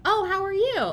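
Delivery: very high, loud, singsong; high chirpy greeting. Retold or imitated. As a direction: chirp it like a hallway filler. Mocking a filler greeting.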